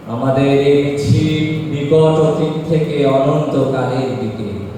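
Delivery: declaimed poem with rising, sustained phrases; poetry recitation. Acted. Reciting a poem on the unending procession to the audience, rousing declamation. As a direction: recite it solemnly, swelling line by line. আবৃত্তি করব.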